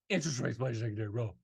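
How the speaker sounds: rattled off fast and low under the breath; quick low throwaway aside. Shown as a demonstration. An annuity agent mumbling a quick aside, fast and low. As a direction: toss it off fast like a footnote. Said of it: "Oh, by the way, if you ask".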